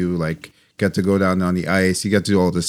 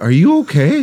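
higher, louder imitation of concern; worried check; 9 semitones higher, 5 dB louder than usual. Friend checking on the guest's hurt eye, worried. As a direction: ask it high, as a retold worried question.

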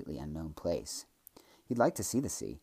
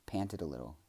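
ordinary delivery

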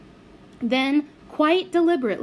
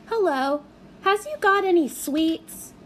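put-on high, sweet little-girl voice; a lightly voiced small girl; simpering little girl; 8 semitones higher than usual. A narrator's reading.

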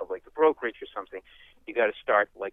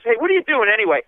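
higher, louder challenge; drunk demanding; 9 semitones higher, 6 dB louder, faster than usual. Retold or imitated. Drunk confronting the comic, high and loud. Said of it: "he goes".

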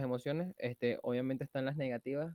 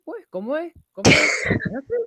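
excited shout; 12 semitones higher, 14 dB louder than usual. Acted. Playful catchphrase shout.

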